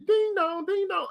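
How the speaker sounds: high sing-song doorbell chime; doorbell imitation. Preacher mimics a doorbell God never rang, high-pitched chime. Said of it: "he didn't ring no doorbell".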